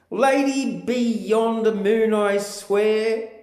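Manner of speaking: high, swooning lover's plea; mock Romeo. Romeo calling up to Juliet at the balcony, high and swooning. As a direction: ham up a lovesick Romeo, pitched high. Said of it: "says he".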